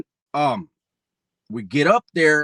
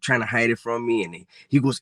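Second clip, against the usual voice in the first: usual speaking voice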